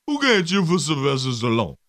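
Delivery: slurred action-star impression; hammed-up boxer voice; Sylvester Stallone. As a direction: mumble it like a punchy movie boxer. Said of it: "the Sly Stallone impre-, im-, impression".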